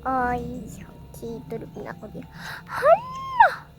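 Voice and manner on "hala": high shocked cry of hala; startled shout. Acted. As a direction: yelp in alarm. Sudden alarmed cry.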